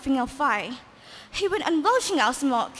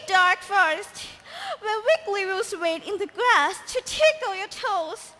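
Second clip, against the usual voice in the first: higher, tearful princess wail; 7 semitones higher than usual. Acted. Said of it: "shrieked the princess".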